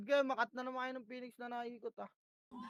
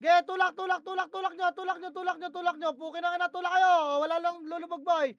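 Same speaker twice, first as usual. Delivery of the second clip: raised, higher, louder commands; urgent push calls; 5 semitones higher, 8 dB louder than usual. Real, not acted. Leading teammates into a push mid-match, urgent loud calls. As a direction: drive the team forward with each call.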